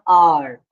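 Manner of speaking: "our" as one long syllable, like "are"; correct pronunciation of our. Shown as a demonstration. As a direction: say "our" low and firm, just like "are". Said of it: "It is our".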